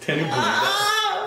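very high, slow, laughing voice; high squeaky impression. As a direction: squeak it like a cartoon. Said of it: "I got an impression from [name]".

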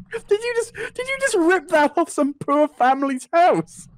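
high, slow incredulous accusation; mock accusation. Friend mock-accusing the roofer, high and slow.

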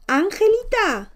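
high, drawn-out call of the name. Pretend. Streamer calling the sleeping baby girl, drawn-out high call. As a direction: call her name across the room, slow and high.

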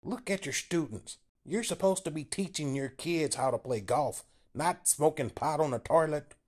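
Paul scolding Bill about his students, high and angry; pissed off. A narrator's reading. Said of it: "he was pissed off".

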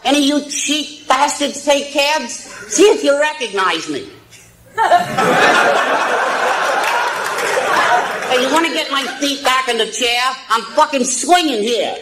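crude puppet voice, cackling; squeaky ventriloquist dummy. Acted. Ventriloquist act, dummy speaking.

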